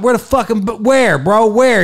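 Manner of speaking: frantic yelling for the condoms; frantic searching. Retold or imitated. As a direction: hunt for them in a rising panic. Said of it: "I'm like".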